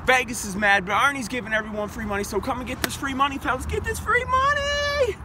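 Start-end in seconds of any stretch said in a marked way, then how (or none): 3.7-5.1 high drawn-out hype call. Acted. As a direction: sing it out high and slow, hyping the crowd. Hyping free money picks.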